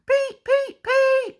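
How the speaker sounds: very high piping peep peep; a little engine whistling for help; whistling Percy. A narrator's reading. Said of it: "whistled Percy".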